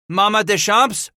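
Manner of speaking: high, loud call by name; yelling. A narrator's reading. Paul calls to the matriarch in the stockade, yelling. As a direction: yell out to her across the field. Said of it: "Paul yelled".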